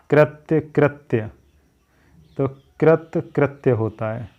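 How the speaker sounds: ordinary talk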